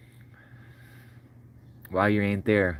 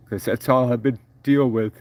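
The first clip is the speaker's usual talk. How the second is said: real crying; 3 dB louder than usual. Real, not acted. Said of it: "I'm already crying".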